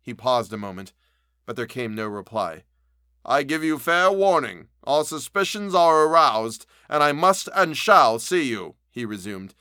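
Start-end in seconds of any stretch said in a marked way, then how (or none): 3.2-8.8 higher, louder stern warning; raised threatening voice. A narrator's reading. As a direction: warn him loudly through the locked door. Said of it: "cried Utterson with a loud voice".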